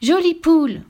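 put-on high, loud child's cry; child's shout. A narrator's reading. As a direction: cry it out like an insistent kid. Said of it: "cria l'enfant". (cri d'enfant aigu et fort)

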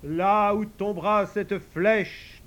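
slow, high solemn farewell; dying hero. Acted. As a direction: fade like a dying legend. Dying Robin's last words.

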